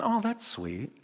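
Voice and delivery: high, soft mock swoon; mock sweet reaction. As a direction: coo it like a touched fan. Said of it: "And I went".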